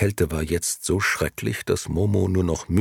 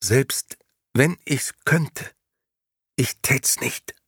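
put-on a small girl forcing out slow, higher words; struggling Momo; 7 semitones higher, slower than usual. A narrator's reading. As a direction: squeeze the words out against the cold. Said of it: "brachte sie schließlich hervor".

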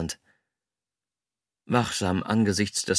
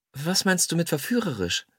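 higher-pitched, wary female voice; cautious question; 8 semitones higher than usual. A narrator's reading. Woman unsure what he means by seductive, cautious. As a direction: ask it carefully, pitched up. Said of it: "fragte sie vorsichtig".

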